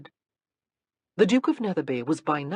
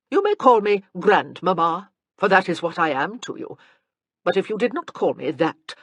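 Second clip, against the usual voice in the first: put-on louder, haughty old grandmother; lofty grande dame, louder; imperious dowager; 8 dB louder than usual. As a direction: look down on her while granting a favor. Dowager Countess addresses Anna.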